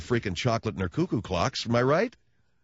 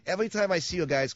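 normal talking voice

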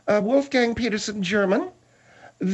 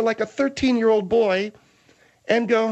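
usual speaking voice

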